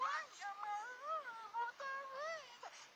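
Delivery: high, slow falsetto sob; mock weeping diva. Lila crying to the host on live TV, high falsetto sob. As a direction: whimper it like a weepy TV star. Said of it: "Lila igualito que yo llorando".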